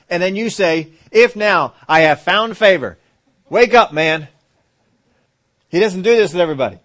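loud, high, driving sermon; full-force preaching. Real, not acted. Pastor pressing the congregation about Gideon, preaching loud.

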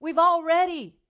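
preacher sternly ordering a woman to stop begging; firm command. Retold or imitated.

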